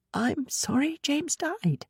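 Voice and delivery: halting apology, throat tight; throat catching. Acted. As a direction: choke the words out gently. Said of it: "her throat caught".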